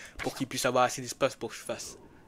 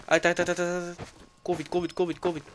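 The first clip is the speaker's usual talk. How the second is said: scared horror game streamer; 4 semitones higher, 6 dB louder, much faster than usual. Real, not acted.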